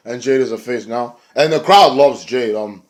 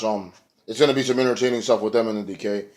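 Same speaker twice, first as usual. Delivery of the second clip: ordinary talk, nothing marked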